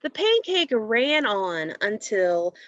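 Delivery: lively storybook reading, a little slow; reading to children. Acted. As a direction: read it aloud for young listeners.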